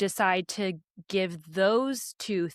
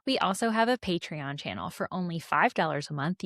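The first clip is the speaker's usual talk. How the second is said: ordinary talk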